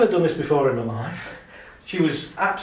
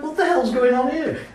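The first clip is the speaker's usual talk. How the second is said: surprised outburst; 9 semitones higher, 5 dB louder than usual. Retold or imitated. Depressed woman suddenly laughing.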